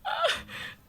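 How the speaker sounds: high airy sigh of being touched; overdone sentimental sigh; breathy mock-emotional sigh. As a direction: let out a hammed-up sigh, all breath. Overcome reading glowing review.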